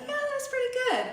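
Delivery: very high, quick, unconvincing praise; feigned approval. Retold or imitated. Dad politely fakes approval of tie.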